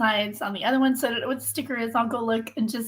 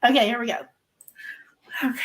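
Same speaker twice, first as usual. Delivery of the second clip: usual speaking voice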